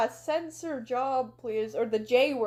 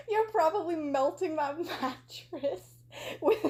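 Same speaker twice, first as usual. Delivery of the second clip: laughing through the words, higher voice; real giggling; 4 semitones higher than usual. Real, not acted. Reader failing to finish the insult, laughing. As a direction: try to get the insult out without cracking up. Cracking up while reading.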